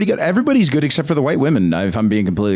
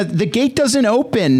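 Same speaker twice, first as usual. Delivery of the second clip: whiny driver text; 11 semitones higher, slower than usual. Retold or imitated. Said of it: "three messages from the driver".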